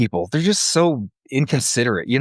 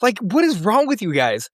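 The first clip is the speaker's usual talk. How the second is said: mock exasperation; 14 semitones higher than usual. Retold exasperated outburst at vet.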